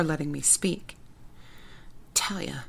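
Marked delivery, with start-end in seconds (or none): 2.1-2.6 faint, small squeak; weak squeak. Acted. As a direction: barely get the name out. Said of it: "I squeaked".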